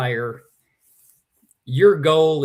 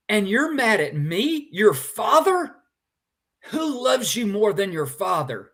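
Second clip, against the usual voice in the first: hurt disbelief; 6 semitones higher than usual. Retold or imitated. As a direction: ask it like a stung father. Father hurt, echoing earlier line.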